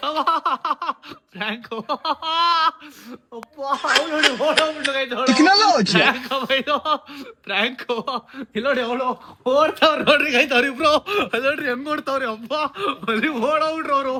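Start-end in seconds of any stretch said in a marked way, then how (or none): 1.3-5.1 helpless laughter breaking into the words; real giggling through the words; laughing. Real, not acted. Laughing as prank is revealed. 5.9-7.8 giggling, words breaking up; real laughter in the voice; laughing. Real, not acted. Laughing as prank is revealed. 9.5-14.2 high, helpless laughing commentary; pitched-up words squeezed through laughter. Real, not acted. Laughing at accomplices running away.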